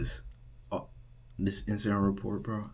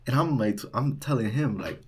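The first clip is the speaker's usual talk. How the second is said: usual speaking voice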